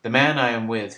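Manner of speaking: usual speaking voice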